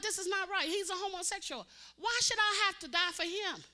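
high, loud preaching cry; preaching at full force. Acted. Preacher crying out to the church, high and loud. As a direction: cry it out to the congregation.

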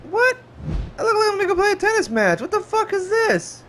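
high-pitched incredulous squeal; mock outrage. Acted outrage at tennis outfit.

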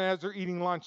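normal talking voice

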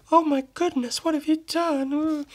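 put-on high gasping shocked girl's voice. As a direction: play the stunned girl. Voicing shocked girl in comic.

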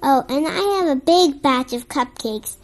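ordinary delivery, nothing marked

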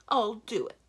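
loud, decisive giant; sudden decision. A narrator's reading. Giant taking up consulting advice, sudden decision. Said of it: "said with sudden decision".

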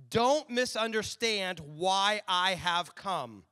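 slow, emphatic scripture reading. Acted. Pastor reading Jesus' words to the church, slow and emphatic. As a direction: read Jesus' words with weight.

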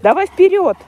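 high, loud shout to the dog; loud cheering. Real, not acted. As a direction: call the dog on, eager. Urging dog to swim.

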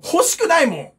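usual speaking voice

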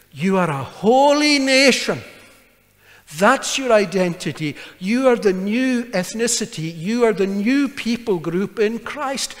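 rousing sermon climax; preaching at full force. Acted. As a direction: proclaim it over the congregation.

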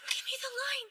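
hissed plea to the prompter; hushed call for a forgotten line; stage whisper. Acted. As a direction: whisper urgently for your cue. Forgotten line, asks prompter offstage.

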